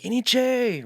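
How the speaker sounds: high, loud call across a field; yelled greeting. Retold or imitated. Hailing a farmer working in the fields, yelled greeting. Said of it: "I kinda yell out to him".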